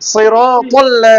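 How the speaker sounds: সিরাতাল্লা drawn out slowly, loud and clear; slow model recitation. Shown as a demonstration. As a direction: spell out সিরাতাল্লা slowly for a child to copy. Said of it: "teacher models for student". (ধীরে ধীরে টেনে শেখানো)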